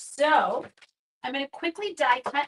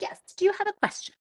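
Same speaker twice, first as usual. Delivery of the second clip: bright, higher teacher calling on a pupil; 11 semitones higher, faster than usual. Pretend. Crafter playing teacher to a viewer with her hand up. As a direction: play the teacher picking a raised hand. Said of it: "So I'm like".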